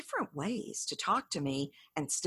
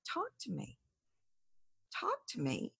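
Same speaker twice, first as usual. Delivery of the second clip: soft invitation; 4 semitones higher, 7 dB quieter than usual. Shown as a demonstration. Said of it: "I'm saying".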